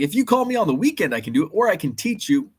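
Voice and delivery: high, fast chirpy imitation of the installer; mimicking tech guy. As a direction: rattle it off like an eager tech. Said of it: "He's like".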